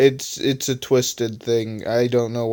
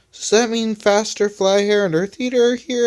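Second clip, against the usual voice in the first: Rubber's voice; 9 semitones higher than usual. Acted.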